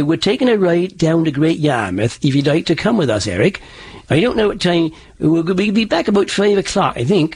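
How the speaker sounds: East Anglian settler accent, lightly done; a coach showing the settlers' East Anglian speech; old East Anglian accent demo. Shown as a demonstration. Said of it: "they sounded like this".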